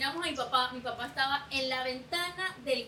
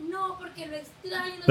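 crying; 5 semitones higher, 8 dB louder than usual. Retold or imitated. A tipsy daughter crying to her uncles about her dad. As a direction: whimper it like a drunk crying girl. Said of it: "Yo empecé a llorar".

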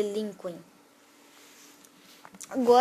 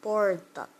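"Porta" with a firm first syllable "Por"; stress on POR. Shown as a demonstration. Teacher showing the stronger syllable, "Porta" stressed on "Por". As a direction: lean on "Por" and let "ta" fall away. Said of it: "o por é emitido com mais força".

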